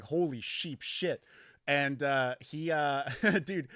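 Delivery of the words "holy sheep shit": mock astonishment. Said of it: "and I was like".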